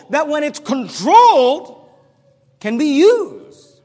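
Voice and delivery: loud, high, drawn-out words; emphatic preaching. Real, not acted. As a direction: preach it slowly at full volume. Preacher driving his point home.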